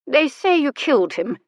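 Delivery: usual speaking voice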